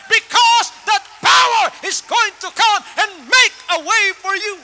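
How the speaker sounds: a shouted sermon climax; full-force preaching. Acted. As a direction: preach it out with everything you have.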